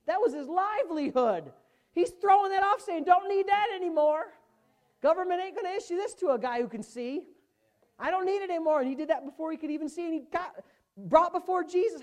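high, loud, climbing sermon; preaching at full force. Real, not acted. Preacher to congregation, fiery high sermon. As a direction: drive the story home with fire.

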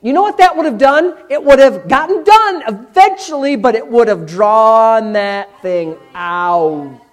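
loud, high, drawn-out preaching; full-force preaching. Real, not acted. Preacher driving home to congregation that change takes time, loud and emphatic. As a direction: preach it hard, stretching key words.

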